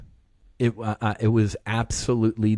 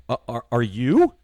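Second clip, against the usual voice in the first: louder, stammered question back; imitated stunned concern; 6 dB louder than usual. Stunned onlookers asking back.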